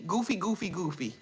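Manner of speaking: usual speaking voice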